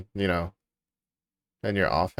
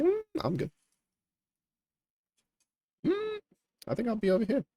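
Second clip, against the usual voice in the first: high, soft, nervous little voice; timid small voice; 12 semitones higher, 6 dB quieter than usual. Retold or imitated. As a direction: squeak it out, backing away. Declining the spooky place.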